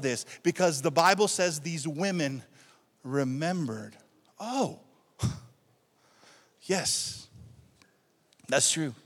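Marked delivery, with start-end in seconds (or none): none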